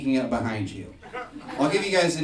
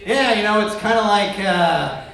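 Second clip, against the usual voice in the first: awkward small talk; 5 semitones higher, 6 dB louder than usual. Retold or imitated. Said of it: "I turn around and I go".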